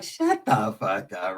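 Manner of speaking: mock dismissal. Brushing off Ricky's mind reading, slow and soft. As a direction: drag it out low and dismissive. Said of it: "And I'm like".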